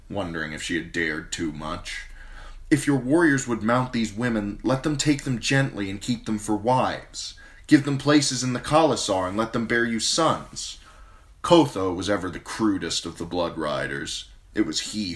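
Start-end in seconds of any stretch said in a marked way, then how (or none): 2.7-10.8 put-on higher young queen, firm plea; Danny's voice. Queen urges the khal to spare the women, higher firm voice. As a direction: plead firmly for the captives.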